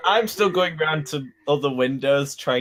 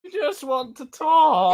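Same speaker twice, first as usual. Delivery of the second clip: calling out, pleading; 10 semitones higher, slower than usual. Acted. Arguing with hermit through chimney.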